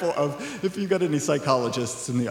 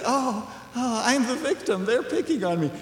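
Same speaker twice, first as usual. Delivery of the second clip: higher, put-on self-pitying bully; hammed-up poor-me voice; mock whiny victim; 7 semitones higher than usual. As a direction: whine like the bully playing victim. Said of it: "the bully saying".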